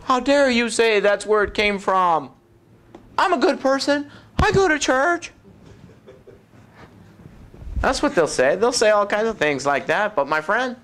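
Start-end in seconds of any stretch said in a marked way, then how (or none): 0.1-5.3 higher-pitched indignant protest; indignant churchgoer. Acted. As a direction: protest like an offended churchgoer, voice climbing. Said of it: "And they'll go".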